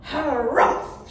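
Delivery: a cross harrumph of bad mood; grumpy harumph. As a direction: huff it out, annoyed. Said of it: "which is a bad mood noise".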